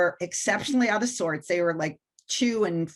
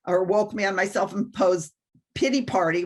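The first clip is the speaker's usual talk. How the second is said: ordinary delivery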